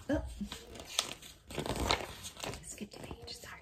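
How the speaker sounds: soft unvoiced whisper to herself; whispered aside. Real, not acted. Teacher whispering to herself after skipping a page. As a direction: breathe it as a quick private aside.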